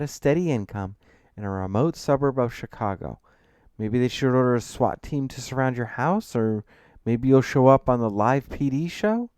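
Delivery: lightly mocking, incredulous questioning; sarcastic. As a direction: build the mockery, lifting into the last question. Friend sarcastically mocks the client.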